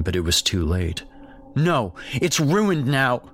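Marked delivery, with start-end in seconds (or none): 1.5-3.2 mother yells. Acted. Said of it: "she yelled".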